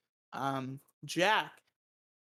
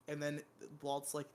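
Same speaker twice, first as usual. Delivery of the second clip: normal talking voice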